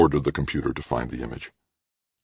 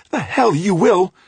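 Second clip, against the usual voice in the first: loud, slow, high-pitched retort; furious defiance; 7 dB louder than usual. A narrator's reading. Trudy defies Grant in quarrel.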